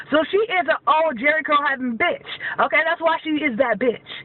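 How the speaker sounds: normal talking voice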